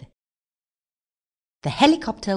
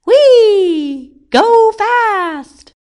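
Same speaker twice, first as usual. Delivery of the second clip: very high, loud squeal of glee; gleeful shout; 11 dB louder, much slower than usual. A narrator's reading. King thrilled on the turning wheel, gleeful shout. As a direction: whoop it out, long and high. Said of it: "shouted the king".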